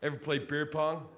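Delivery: lazy frat guy pitching a game; lightly voiced college bro at a bar; college boy. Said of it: "college boy who'll come to me and go".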